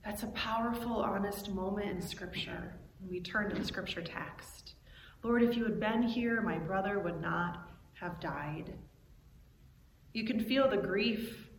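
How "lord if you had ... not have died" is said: subdued, softer, sinking at the end; quiet repetition. Acted. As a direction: echo the lament softly and low. Quiet repeat of Mary's outcry.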